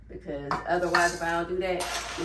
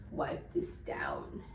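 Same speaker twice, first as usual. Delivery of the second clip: whisper; 8 dB quieter, much slower than usual. Real, not acted. Whispered aside while cleaning.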